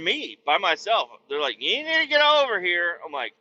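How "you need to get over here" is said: high, louder bossy call; high mocking summons; mock nagging call. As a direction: call him in like a worried mom. In-laws calling again.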